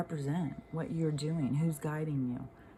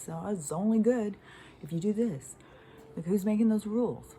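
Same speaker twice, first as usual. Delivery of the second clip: louder and higher, rising indignation; heated rant; 6 semitones higher, 5 dB louder than usual. Real, not acted. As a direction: demand to know who made the rules. Angry rant about rules.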